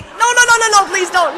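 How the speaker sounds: high, loud, hammed-up begging; mock pleading. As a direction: beg her not to tell anyone, half-joking. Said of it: "I'm like".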